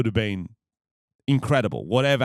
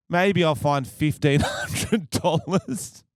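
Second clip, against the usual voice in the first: higher, slow mocking of the price; mock incredulous; 6 semitones higher, slower than usual. Stalling the agency lady over her price, mockingly incredulous. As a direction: drag out the sum in high disbelief.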